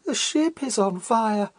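high, slow, anguished cry of alarm; faint agonized cry. A narrator's reading. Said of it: "cries out in a faint, agonized voice".

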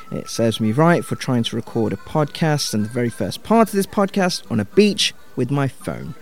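ordinary talk

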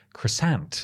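croissant said as kruh-SANT, American style; American accent. Shown as a demonstration. Showing the American way to say croissant, in an American accent. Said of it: "as the Americans would pronounce it".

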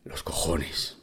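hushed scornful whisper under the breath; a muttered whisper of disdain; whispered aside. Real, not acted.

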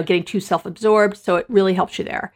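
usual speaking voice

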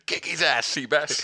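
high, laughing quote; movie quote voice. Retold or imitated. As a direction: sing it out with a laugh. Quoting film line.

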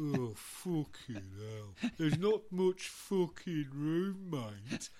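slow, dragging, fed-up moan; weary grumble. Acted. Miserable band to the drummer wanting a ride, slow grumble. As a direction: groan it out slowly, unwelcoming.

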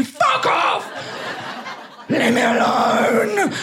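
snapping at a husband from the shower; angry shout. Retold or imitated. Mock outraged shout at the intruding husband.

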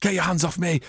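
mock-serious acting. Pupils in a serious scene, mock distress. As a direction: play at earnest school drama. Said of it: "be like a serious scene like that".

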